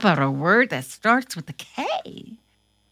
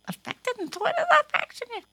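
puzzled puppet voice; 14 semitones higher, much faster than usual. Acted. Puppet Corona puzzled by word.

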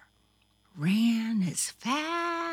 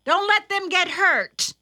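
high, loud motherly instruction; mother warning; 12 semitones higher, 7 dB louder than usual. A narrator's reading. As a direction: caution a child from across the field.

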